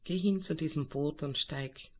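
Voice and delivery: calm guided meditation voice; right in your ear. Acted. Leitet eine Meditation an. (ruhige Meditationsstimme)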